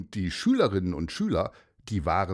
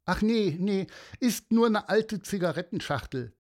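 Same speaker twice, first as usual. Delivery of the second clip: disappointed; 10 semitones higher than usual. Acted. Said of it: "rief Mia".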